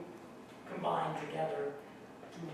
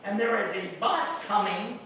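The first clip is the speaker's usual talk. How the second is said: ordinary delivery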